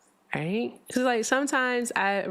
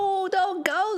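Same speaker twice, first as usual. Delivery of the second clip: pleading; 14 semitones higher, slower than usual. Real, not acted. Pleading as cohost leaves.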